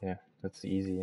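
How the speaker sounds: ordinary delivery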